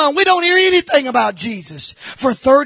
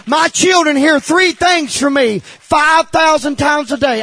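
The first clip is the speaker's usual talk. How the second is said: ordinary talk, nothing marked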